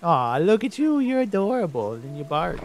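loud, high gushing over an animal; delighted cooing. Real, not acted. Player greeting an adorable fox, high excited delight. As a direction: fuss over an adorable pet.

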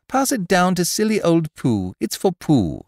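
put-on high, loud eager boy; cheerful child calling; excited. Christopher Robin character voice.

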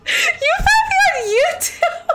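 high, slow words through real laughter; laughing in disbelief. Real, not acted. Viewer found her on YouTube, she laughs in high disbelief. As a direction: laugh it out, can't believe it. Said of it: "I'm sorry, I'm not laughing".

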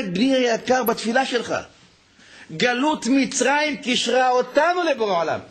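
high, impassioned preacher's call; fervent preaching. Acted. Rabbi urges listeners to turn to God, preaching fervently. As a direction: rouse the congregation.

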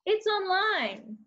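very high, drawn-out exclamation; maximum level. Shown as a demonstration. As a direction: stretch it out high with exaggerated emphasis. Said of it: "we had it to the maximum level".

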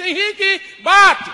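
very high, loud sing-song call; flirty call.

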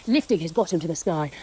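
ordinary delivery, nothing marked

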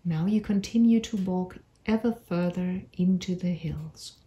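unhurried meditation voice with long pauses; measured storytelling for a calming visualisation; guided meditation. Acted. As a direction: guide them gently through an imagined walk, phrase by phrase. Leads a guided imagery meditation.

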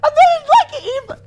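overwhelmed squeaky voice; voice gone high. Real, not acted. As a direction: squeak it out, half laughing half crying. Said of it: "I'm sorry, my voice is high".

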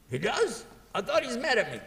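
high, surprised, a bit amused; surprised brother. Acted. Brother hearing the other likes him, surprised. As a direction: sound genuinely caught off guard. Brother reacts in acted dialogue.

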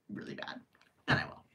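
whispered really bad, almost silent. Real, not acted. Stressing how bad, in a whisper. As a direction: drop it to a hush.

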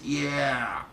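ordinary talk

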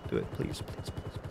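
soft, whispered begging; whispered pleading. Real, not acted. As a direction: plead under your breath. Begging the game to cooperate.

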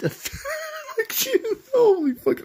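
high-pitched, breaking up laughing; laughing too hard. Real, not acted. Player cracking up at his own answer, laughing hard. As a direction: squeal with real laughter through the words. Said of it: "I'm laughing too hard".

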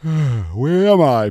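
slow, drawn-out yawn on the words. Acted. Peter waking in a strange bed, yawning slowly. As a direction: stretch the words through a yawn. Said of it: "Peter yawned".

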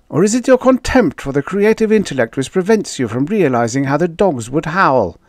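high, loud, rushed protest; an alarmed man pushing back hard; agitated Da Vinci Leo. Acted.

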